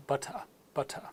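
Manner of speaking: butter with a clearly said T, final R dropped; British clear T. Shown as a demonstration. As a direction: lengthen the last vowel of butter, no R. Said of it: "said clearly".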